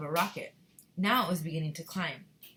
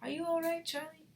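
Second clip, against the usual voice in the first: high-pitched, concerned grandfather; Grandpa Joe; 10 semitones higher than usual. A narrator's reading. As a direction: check on the child in a high voice. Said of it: "and he said".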